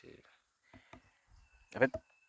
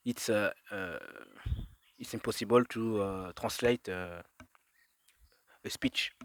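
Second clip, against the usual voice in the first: put-on halting English with a French accent; bad French-accented English; 2 semitones lower, much slower than usual. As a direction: search for the English words in a thick French accent. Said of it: "Désolé pour mon accent pourri". (mauvais accent français en anglais)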